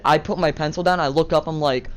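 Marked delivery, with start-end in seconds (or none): none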